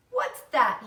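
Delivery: put-on loud, sharp boy's demand. A narrator's reading. Avery to his family, loud demand. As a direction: bark the question at the room. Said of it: "he demanded".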